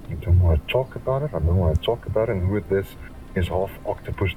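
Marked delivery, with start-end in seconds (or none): 0.1-2.4 fast, flustered brush-off; embarrassed captain. Acted. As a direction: rush it out to shut the topic down. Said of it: "he gets a very red blush".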